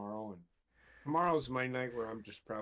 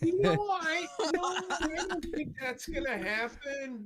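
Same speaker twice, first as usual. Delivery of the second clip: high squeak; 5 dB louder than usual. Real, not acted. Protesting getting naked.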